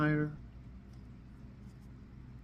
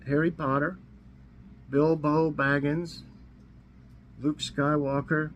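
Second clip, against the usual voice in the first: ordinary talk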